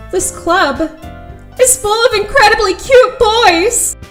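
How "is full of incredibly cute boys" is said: high, loud, drawn-out outburst; overwhelmed exclamation. Acted. Newcomer seeing the club's boys, overwhelmed squeal. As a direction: burst out in giddy amazement.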